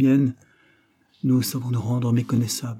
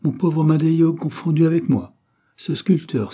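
raised, louder amused protest; exclaiming; 7 semitones higher, 4 dB louder than usual. A narrator's reading. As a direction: exclaim it, pitched up and emphatic. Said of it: "s'écria-t-il".

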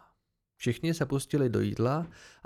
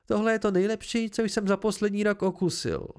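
high-pitched, mildly exclaimed praise; exclaiming praise; 6 semitones higher than usual. A narrator's reading. Diner to the cook after tasting, exclaiming with delight. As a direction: gush a little, pitched up. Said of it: "zvolal jsem".

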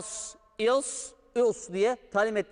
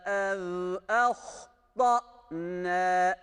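Quran recitation; faster than usual. Shown as a demonstration. Teacher recites the verse for students, chanted.

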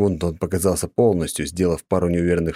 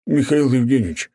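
higher, louder desperate plea; begging; 8 semitones higher, 3 dB louder than usual. A narrator's reading. Terrified janitor begging the viscount for mercy. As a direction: beg for mercy for your children. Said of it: "взмолился дворник".

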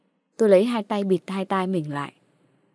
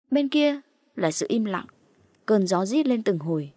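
ordinary delivery, nothing marked

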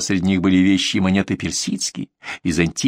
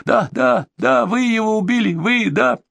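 high, frantic insistence; hysterical accusation; 12 semitones higher, slower than usual. A narrator's reading. Bandit accuses them of a killing, hysterical and high. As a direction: climb sharply into a hysterical high pitch. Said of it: "в истерике кричал Кацо".

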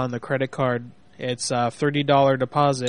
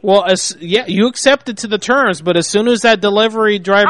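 loud, insistent arguing; raised voice; 5 semitones higher, 7 dB louder than usual. Real, not acted. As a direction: press the point loudly. Manager argues loudly.

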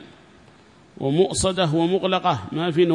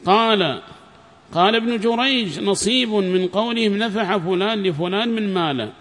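higher-pitched formal recitation of a book; formal lesson reading voice; reading a text aloud; 5 semitones higher, 4 dB louder than usual. Acted. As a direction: read the passage out clearly to a class.

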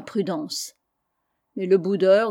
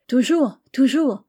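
two higher, louder cries; eager insistence; 4 semitones higher, 6 dB louder than usual. A narrator's reading. Épiméthée begging the visitor to stay, eager insistence. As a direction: insist eagerly, each word thrown out.

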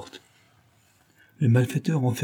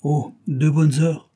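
slow, higher, drawn-out reply; slow gloating; 6 semitones higher, 4 dB louder, slower than usual. Acted. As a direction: savour the cruelty. Chief gloats over drowning time.

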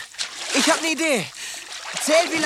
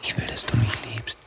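hushed whisper; 13 semitones lower, 5 dB quieter than usual. Acted. Inner whispered voice.

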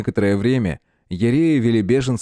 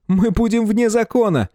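high panicked voice; 12 semitones higher than usual. A narrator's reading. Neighbour panics.

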